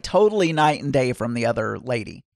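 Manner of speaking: usual speaking voice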